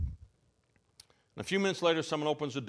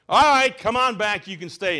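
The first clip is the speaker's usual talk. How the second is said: yell; 5 semitones higher, 9 dB louder than usual. Retold or imitated. Cook yelling to the leaving crowd to come back, loud. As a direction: call everyone back, giving in. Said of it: "the man with the greasy apron yells".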